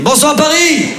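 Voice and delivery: high, ringing stage greeting. Retold or imitated. [name] live recording imitated.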